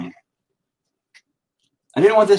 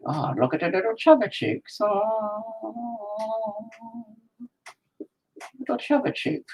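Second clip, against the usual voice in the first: high, soft mock cooing; baby-talk cooing; 10 semitones higher, 7 dB quieter than usual. Painter fawning over the painted cheeks, high soft baby talk. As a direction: coo softly and high, as to a baby.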